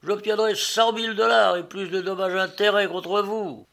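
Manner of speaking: high-pitched indignant threat; outraged yelling. A narrator's reading. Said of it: "hurla de nouveau le gros homme".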